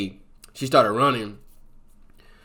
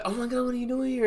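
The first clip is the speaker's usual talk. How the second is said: much higher, fast shocked squeal; shocked surprise; faster than usual. Retold or imitated. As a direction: squeal at the unexpected ex. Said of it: "she's like".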